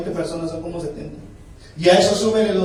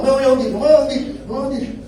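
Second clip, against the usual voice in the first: higher-pitched repeated self-assurance; Pedro dándose ánimo; 4 semitones higher, faster than usual. Acted. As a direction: talk yourself out of sinking. Actúa a Pedro sobre el agua. (voz aguda dándose ánimo)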